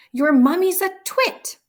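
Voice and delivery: very high, loud roaring insult; bellow. A narrator's reading. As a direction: blast it at the child. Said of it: "the Trunchbull bellowed".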